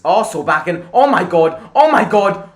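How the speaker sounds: loud, high-pitched outburst of amazement; real astonished shouting. Real, not acted. Watching fan stunned by Solbakken's shot, astonished shout.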